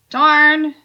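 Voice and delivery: disappointed darn. Acted.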